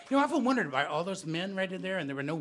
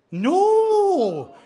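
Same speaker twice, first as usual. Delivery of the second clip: high, loud comic shout; big shouted no; 13 semitones higher, 12 dB louder than usual. Acted. Storyteller shouts the answer with the audience, loudly. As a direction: belt it out for the crowd.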